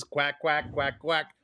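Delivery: quacking like a cartoon duck. Duck hunting game duck sounds.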